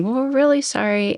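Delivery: high-pitched, placating apology; apologetic. Retold or imitated. Two drivers apologizing to an angry woman, apologetic.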